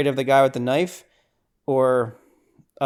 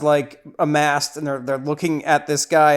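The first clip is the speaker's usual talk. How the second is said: usual speaking voice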